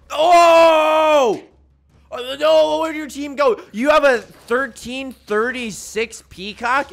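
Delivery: loud, high-pitched cry of shock; shocked outburst. Real, not acted. Gamer reacting to opponent's thirteen thirty-six peacock, shocked outburst. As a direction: yell it out in stunned disbelief.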